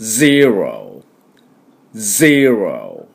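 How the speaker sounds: ゼロ stretched very slowly as English zero. Shown as a demonstration. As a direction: draw out ゼロ with z and relaxed r. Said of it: "私の後について発音しましょう".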